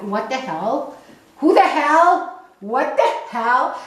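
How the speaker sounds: hammed-up waiters' "What the hell?", rising and loud; outraged waiter quote. Waiters balking at a vegan asking about milk, mock outraged. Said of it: "they would be like".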